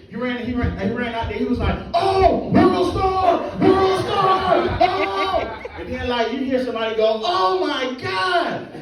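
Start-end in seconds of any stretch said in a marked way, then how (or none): 1.9-5.6 high shouted "world star"; high excited crowd chant; hyped tourist. Retold or imitated. Tourist hyping the spectacle. 7.2-8.7 high, drawn-out shock; mild mock awe; slow stunned "oh my God". Bystander reacts slowly.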